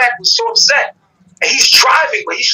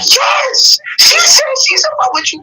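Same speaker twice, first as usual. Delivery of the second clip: excited shout. Retold or imitated. Friend relaying the crush, excited high shout. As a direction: burst out with the big news.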